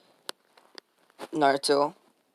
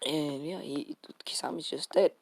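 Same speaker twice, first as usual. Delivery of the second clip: hushed whisper; 6 dB quieter than usual. Real, not acted. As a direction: drop to a whisper for the death. Announcing a character's death.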